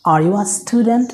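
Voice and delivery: very slow, carefully spaced words; dictation-slow teacher read; slow model sentence. Shown as a demonstration. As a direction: say it slowly so learners can follow. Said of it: "teacher models the English sentence".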